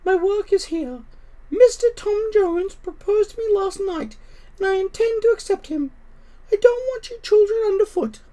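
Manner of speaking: high, firm mother cat voice; a proud mother cat sending her kittens off. Acted. Mother cat telling her kittens to leave, high and firm.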